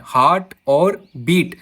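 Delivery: "Heart" and "बीट" slowly set apart; slow, isolated words in "heart और बीट"; separate beats. Shown as a demonstration. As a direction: say "heart और बीट" with pauses round और. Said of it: "या फिर beats को निकाल रहा है".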